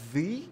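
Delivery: THE with a long ee, pitched high; THE as "thee". Shown as a demonstration. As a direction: say THE as "theee" before a vowel. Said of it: "apple começa com som de vogal".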